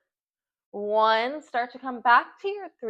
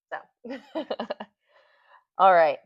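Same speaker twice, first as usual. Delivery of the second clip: laughing; 6 dB louder, much slower than usual. Real, not acted. Laughs at her own struggle.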